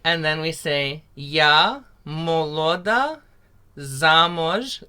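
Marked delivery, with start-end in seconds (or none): 1.1-4.9 "zamoj" with zh as in garage, said slowly; teacher modelling the Ukrainian zh; slow Ukrainian demo. Shown as a demonstration. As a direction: stretch out "Ya moloda zamoj" syllable by syllable. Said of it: "The Z H is like the garage".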